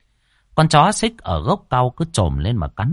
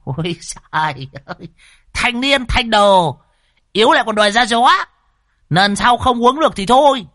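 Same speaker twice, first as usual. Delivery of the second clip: teasing; 9 semitones higher, 4 dB louder than usual. A narrator's reading. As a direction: rib him in a put-on high voice, dropping briefly for an aside. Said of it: "Lão Đồng mới trêu".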